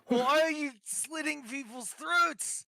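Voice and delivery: high, drawn-out incredulous question; aghast question. Acted. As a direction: demand it in slow, high disbelief. Acted shocked question to Lyra.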